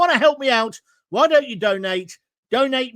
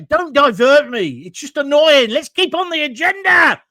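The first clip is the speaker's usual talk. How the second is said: loud, higher-pitched exasperation; angry outburst; 4 semitones higher, 8 dB louder than usual. Real, not acted. Host scolding a live commenter for diverting him, angrily. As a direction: raise your voice in real irritation. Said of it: "I'm being a bit angry".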